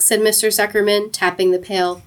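plain reading voice

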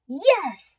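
loud, high eager yes; children shouting together; shouted. A narrator's reading. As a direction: kids shouting yes to their dad. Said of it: "the children shouted".

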